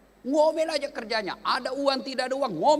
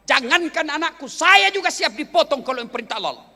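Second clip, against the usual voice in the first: raised, forceful voice of defiance; higher, louder acted indignation; defiant retort; 5 semitones higher, 7 dB louder than usual. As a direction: hurl it back at the tempter, loud. Acted Hajar defying the devil.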